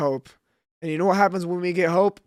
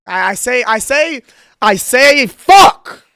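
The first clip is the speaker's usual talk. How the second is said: starts loud and climbs to a furious high scream; mounting frustration bursting into a shout; building to a yell; 6 semitones higher, 14 dB louder, much slower than usual. Real, not acted. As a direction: wind up and explode on the last word. Building rage after interception.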